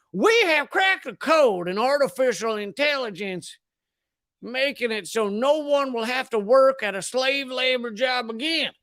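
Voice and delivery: a hint of a Southern scientist, proud; mild Southern accent on a scientist announcing a breakthrough. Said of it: "You never give a scientist a killer Southern accent".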